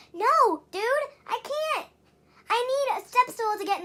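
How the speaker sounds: high, loud frustrated protest; exasperated outburst. Real, not acted. Fed up, answering her dad loudly and high. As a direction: push back hard, fed up.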